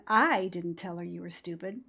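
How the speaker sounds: emphasis on the opening "I"; stress on I. Shown as a demonstration. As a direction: hit the word "I" hard. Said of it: "emphasize the first word".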